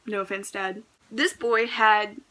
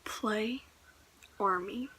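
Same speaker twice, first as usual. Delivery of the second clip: "Play army" very soft, slow, each word left hanging; deadpan disbelief; 10 dB quieter, slower than usual. Real, not acted. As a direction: drop the words slowly in stunned silence. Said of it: "Just a moment of silence".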